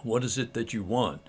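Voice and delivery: unhurried, soft and serene, like someone who needs nothing; a quiet, peaceful monk offering the stone back; calm monk. Retold or imitated. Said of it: "very calmly says".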